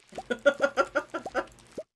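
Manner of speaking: loud, high burst of laughter; surprised, confused laugh. Real, not acted. Streamer caught off guard by a chat confession, surprised confused laugh. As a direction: laugh out loud in startled disbelief. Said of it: "a laughter of surprise and confusion".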